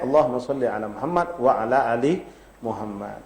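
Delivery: recited salawat. Shown as a demonstration. Ustaz showing the shortest salawat, recited. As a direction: recite it like in prayer. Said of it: "tapi minimal paling pendek".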